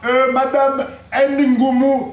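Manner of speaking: "N Ngoumou" with the N spelt out as "enn", drawn out; mispronounced name. Acted. Official calling out madame's name, "N Ngoumou" with a separate "enn". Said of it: "ça se prononce Ngoumou".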